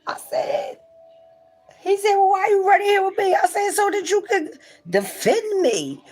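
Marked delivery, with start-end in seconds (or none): 1.8-6.0 high, loud playful retold dialogue; playful retelling. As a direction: squeak out the silly exchange.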